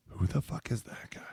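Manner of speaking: whispered, confused aside; hushed bewildered whisper. Retold or imitated. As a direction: mutter it under your breath in confusion. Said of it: "at times I'm like".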